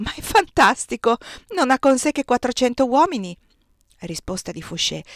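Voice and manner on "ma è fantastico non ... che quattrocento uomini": louder, raised, eager outburst; excited disbelief. A narrator's reading. Young Mario bursts out at the news, excited. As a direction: burst out as if it's unbelievable news. Mario reacts to Napoleon's landing.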